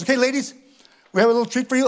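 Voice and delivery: a hint of a chirpy host introduction; organizer addressing crowd. Organizer teasing a treat to the ladies, lightly voiced host. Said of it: "she goes like this".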